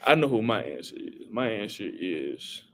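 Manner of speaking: ordinary delivery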